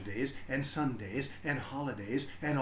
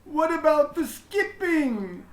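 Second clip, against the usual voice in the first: put-on high, loud child's voice calling; a kid brother protesting from the doorway; little brother calling out; 14 semitones higher, 9 dB louder, slower than usual. A narrator's reading. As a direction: call out like an upset little boy. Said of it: "her brother called".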